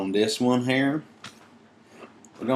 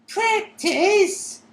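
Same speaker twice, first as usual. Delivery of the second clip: very high squealed outrage; furious elf squeal; 5 dB louder than usual. Acted. Winky squealing furiously at Dobby. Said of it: "squealed Winky furiously".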